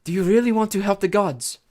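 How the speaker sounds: silky, lilting persuasion; coaxing villainess. A narrator's reading. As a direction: tempt the hero away from his duty. Medusa coaxing Percy in character.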